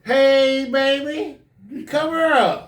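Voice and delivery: high, loud calling out; hammed-up woman calling to him; street woman voice. Addict woman hailing the teacher, high loud calling.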